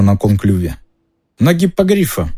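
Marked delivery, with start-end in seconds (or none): none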